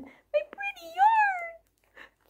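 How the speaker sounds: high stretched squeal over the yarn; gushing squeal. Real, not acted. Vlogger squealing over yarn from a friend, high and stretched. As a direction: swoon over the pretty yarn.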